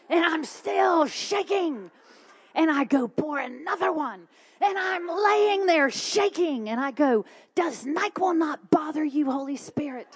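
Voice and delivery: hammed-up frantic storytelling; exasperated. Retelling frantic sleepless night.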